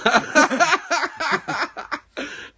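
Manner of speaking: long, high fit of laughter. Real, not acted. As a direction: laugh uncontrollably, high-pitched. Laughing at the caller. (long fou rire aigu)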